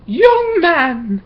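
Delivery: put-on a shrill-pitched schoolteacher calling out a late boy; stern Miss Block. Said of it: "said Miss Block".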